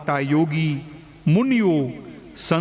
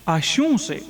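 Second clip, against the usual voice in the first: higher, sudden startled question; startled gasp; 7 semitones higher than usual. A narrator's reading. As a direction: jolt back in shock at what he sees. (ચોંકીને, ઊંચા અવાજે પૂછે)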